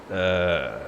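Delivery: unimpressed grunt. Retold or imitated. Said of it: "unimpressed noise".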